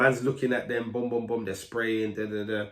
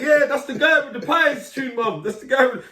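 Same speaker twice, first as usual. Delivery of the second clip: excited to mum; 8 dB louder than usual. Retold or imitated. Excitedly telling mum about the artist.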